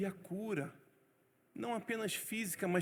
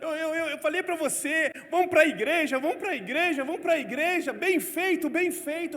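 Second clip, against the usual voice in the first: higher and much louder, nagging wife; wife scolding her husband, higher and loud; 7 semitones higher, 8 dB louder than usual. Retold or imitated. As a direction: shout it like a furious told-you-so wife.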